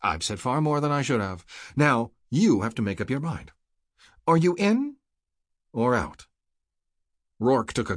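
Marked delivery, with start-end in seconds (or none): 0.0-6.3 pointed demand, jumping high on the question; brisk. A narrator's reading. Havelock makes Rourke choose in or out, brisk. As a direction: press firmly for a decision. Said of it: "Havelock spoke briskly".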